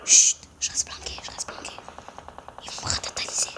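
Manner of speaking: hushed hiding whisper; whispering while hiding. Real, not acted. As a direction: whisper so they won't hear.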